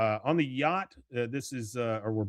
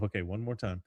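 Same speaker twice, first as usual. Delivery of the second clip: softer, low-key resolve; talking to himself; 3 semitones lower, 7 dB quieter than usual. Retold or imitated. Bill steels himself alone, softly to himself. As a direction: say it under your breath, to yourself. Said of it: "he kinda says to himself".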